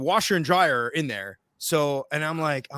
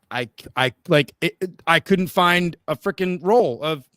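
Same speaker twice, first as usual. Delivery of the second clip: plain reading voice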